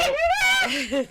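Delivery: squealing burst of laughter; a high squeal of laughter. Real, not acted. Mother laughing at son.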